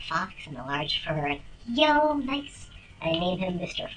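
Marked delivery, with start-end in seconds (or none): 1.7-2.7 excited. Real, not acted. Excited reaction to finding something.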